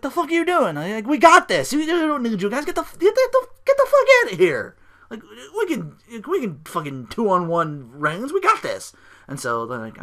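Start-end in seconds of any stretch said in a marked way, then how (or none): 0.0-8.9 high, loud, fast ranting shout; hammed-up screaming boss; angry yell. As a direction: chase the guards off in a fury. Rollins sending security away.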